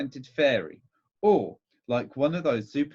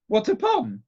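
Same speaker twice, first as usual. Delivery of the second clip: sharp, high and loud question; 9 semitones higher, 5 dB louder than usual. A narrator's reading. Aunt cutting off the meek doctor's congratulations, sharply. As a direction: demand it sharply, pitched up. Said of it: "said my aunt sharply".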